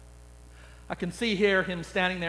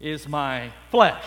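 solemn scripture voice; 2 semitones lower, 4 dB louder than usual. Acted. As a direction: let the last words sink to a hush. Jesus quote again.

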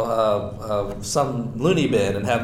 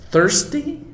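"thirsty?" jumps much higher, rising in disbelief; puzzled question. Shown as a demonstration. Preacher pressing the odd word on his listeners, incredulous. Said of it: "Hungry, I get with the bread".

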